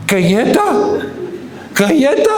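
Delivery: put-on high, rising little-girl plea; mock insistent toddler. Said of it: "Y Rebeca".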